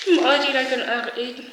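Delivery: giggly, lifted tagline read; laughing tagline. Shown as a demonstration. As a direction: say it like a catchy slogan through a laugh. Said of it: "That should be my tagline".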